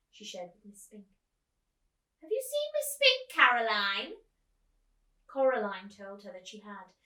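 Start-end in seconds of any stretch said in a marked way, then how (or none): 2.2-4.2 put-on high, loud fluttery old lady calling; a theatrical old actress asking after her friend. Old actress calls out to the girl, high and loud. Miss Forcible voiced high.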